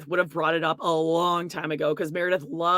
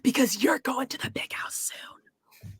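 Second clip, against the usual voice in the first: mock whisper; voiceless conspiratorial aside.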